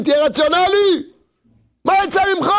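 high-pitched, pleading exclamation; exasperated scolding. Acted. Critic urging the believer to be rational, exasperated scolding.

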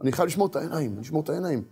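quiet, tense inner vow; tense resolve. Retold or imitated. Man leaving home vowing to guard his eyes, tense and quiet. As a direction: steel yourself under your breath. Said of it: "כולו מתוח".

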